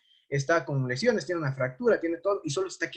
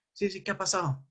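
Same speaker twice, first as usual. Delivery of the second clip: groggy patient; 4 semitones higher, 6 dB quieter than usual. Retold or imitated. Confused patient re-woken.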